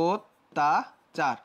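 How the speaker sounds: অত্যাচার drawn out very slowly as "ottachar"; teacher sounding out অত্যাচার slowly; slow pronunciation demo. Shown as a demonstration. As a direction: stretch অত্যাচার slowly with an o at the start. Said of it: "অধ্বনি কীভাবে change হবে".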